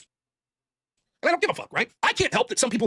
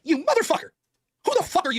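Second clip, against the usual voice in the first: raised, mock-furious retort; angry quote; 8 semitones higher than usual. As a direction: spit it out like he's livid. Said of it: "I was fucking livid".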